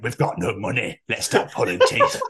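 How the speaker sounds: put-on comic Yorkshire accent meant as Birmingham; mistaken Yorkshire accent. Birmingham dentist short of money, Yorkshire accent. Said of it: "I'm talking a Yorkshire accent in Birmingham".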